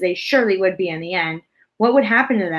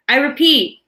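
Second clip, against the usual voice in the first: loud, high, very slow angry shout; urgent angry shout; 5 semitones higher, 4 dB louder, much slower than usual. A narrator's reading. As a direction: drag out the threat furiously. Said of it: "shouted the urgent, angry voice".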